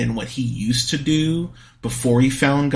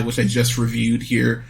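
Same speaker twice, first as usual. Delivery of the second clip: usual speaking voice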